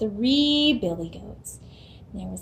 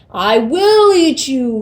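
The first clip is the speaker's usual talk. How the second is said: slow, loud roaring troll; 3 semitones higher, 10 dB louder, slower than usual. Acted. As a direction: roar it down at the little goat. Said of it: "roared the troll".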